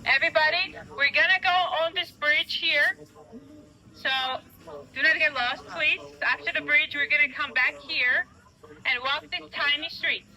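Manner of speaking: loud tour-guide announcement; calling out. Real, not acted. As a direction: project over the street noise to the whole group.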